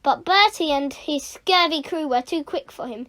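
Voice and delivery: plain reading voice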